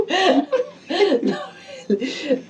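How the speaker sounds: giggling through the words; laughing. Real, not acted. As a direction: laugh while saying it. Partner laughs at joke.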